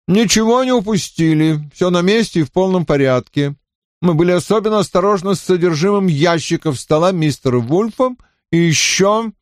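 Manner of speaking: высокий важный доклад; докладывает с важным видом; важно. A narrator's reading. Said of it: "важно доложил он". (важный доклад)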